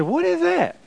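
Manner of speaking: high, surprised what is that; a puzzled neighbor asking. Retold or imitated. Neighbors puzzled, asking about the clock, high and surprised.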